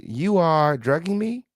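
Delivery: "You are drugging me?" drawn out slowly, climbing high to ME; questioning rise. Retold or imitated. Host checks a quoted line's punctuation, slow questioning rise. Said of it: "There's a question mark there".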